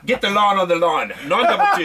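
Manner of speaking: high, laughing South African ad voice; South African accent. Retold or imitated. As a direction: bark the ad line in South African. Tony Greig ad line imitation.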